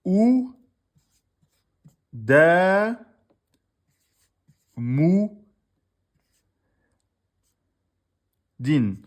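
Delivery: loud spelled-out syllables, each on its own; sounding out a word slowly for students. Shown as a demonstration. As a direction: call out each syllable for the class. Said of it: "Ils ont écrit".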